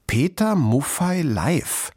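deliberate beginner's reading. A narrator's reading. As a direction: spell out a poster word by word. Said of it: "fuhr Kluge Pfote fort".

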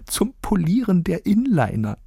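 put-on high, impish old lady; Frau Rosa grinning. A narrator's reading. Frau Rosa hands over embroidered handkerchiefs, grinning. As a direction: say it high, through a sly grin. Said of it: "grinste sie hinter vorgehaltener Hand".

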